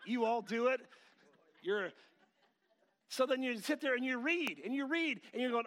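ordinary talk

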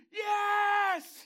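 high, full-force jubilant shout; long, exultant yell; triumphant cheer. Acted. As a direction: cheer like a crowd at the winning moment. Heaven's hosts cheer the victory.

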